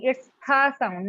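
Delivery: ha said slowly and clearly, stretched out; teaching the sound. Shown as a demonstration. Teacher slowly modelling the ha sound for a student. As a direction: draw out ha so the class hears it. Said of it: "listen to me".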